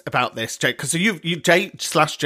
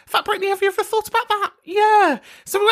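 camp squeal; faster than usual. Acted. Squealing camp address to Brittany.

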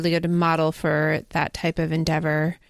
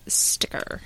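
whispering; 5 dB quieter than usual. Acted. Playful reveal of the sticker.

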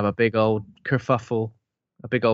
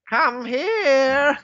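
high eerie ghost call; spooky ghost voice; 4 dB louder than usual. Retold or imitated. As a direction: beckon like a ghost. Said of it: "doing this best spooky voices".

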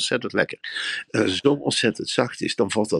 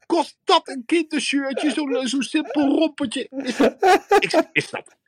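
outraged shopper. Acted. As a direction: rant high and scandalised at the price tags. Indignant shopper in the shop. (zeer hoog en verontwaardigd tierend over de prijzen)

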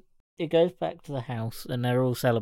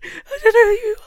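high, loud hammed-up sobbing; mock in tears; 10 dB louder, faster than usual. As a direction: wail it as if crying too hard to speak. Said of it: "In tears, like".

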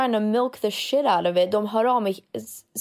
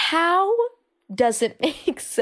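baffled; 7 semitones higher, slower than usual. Retold or imitated. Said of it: "Man bara".

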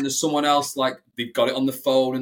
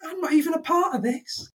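much higher, put-on sulky voice; sulky teen; 14 semitones higher than usual. Mocking Aaron on the podcast, high sulky voice. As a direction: squeak it like a sulky kid left out. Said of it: "Aaron's doing".

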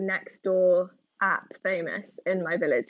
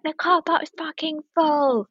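retold scream; 8 semitones higher than usual. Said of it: "she screamed at me".

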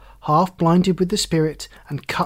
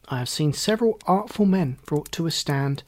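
plain reading voice

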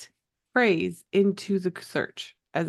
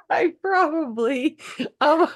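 laughing; 11 semitones higher, 6 dB louder than usual. Real, not acted. Laughing after being teased.

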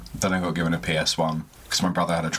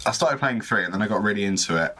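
ordinary talk

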